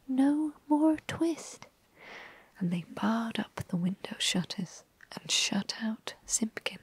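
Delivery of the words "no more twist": slow, much higher voiced squeak of "no more twist"; twittering mouse shout. Mice shouting at Simpkin before barring the shutters, high squeaky shout. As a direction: let the tiny voice pop out aloud. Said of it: "shout at once in little twittering voices".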